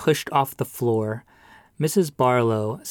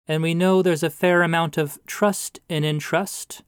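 higher, rising sermonizing pastor; preachy pastor; 6 semitones higher, 3 dB louder than usual. A narrator's reading. Pastor lecturing the widow about trust, preachy. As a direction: build the moral like a sermon.

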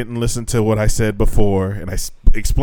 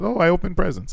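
mock student; 8 semitones higher than usual. Student's easy answer, mimicked.

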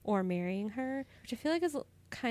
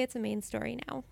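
ordinary delivery

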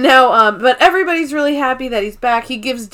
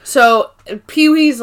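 usual speaking voice

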